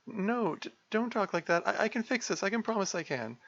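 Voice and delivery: high, stammering, near tears; distraught apology. Acted. Sayori apologising to Monica, high tearful stammer. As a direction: beg forgiveness in a panic.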